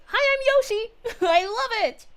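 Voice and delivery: high, loud squeaky Yoshi. Acted. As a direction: pop up as a chirpy Yoshi. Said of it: "Yoshi fan".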